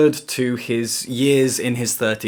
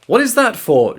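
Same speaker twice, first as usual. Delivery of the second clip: a pitched-up demanding question; vampire demanding an answer from Gabriel, raised pitch; 10 semitones higher, 4 dB louder than usual. A narrator's reading. Vampire Jean-Francois questions the coin, pitched-up demand. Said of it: "Jean-Francois demanded".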